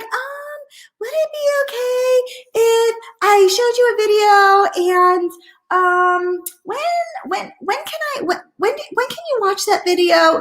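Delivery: high, hesitant mock stammer; timid, unsure asker. Mocking a timid rep stammering an ask to a prospect. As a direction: fumble and stall like you're scared to ask. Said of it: "I'm not being like".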